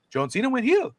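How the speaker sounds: lightly imitated, high-pitched incredulous query; father-in-law's question. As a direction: lift the voice high, as if quoting a baffled relative. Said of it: "my father-in-law asked me the next day".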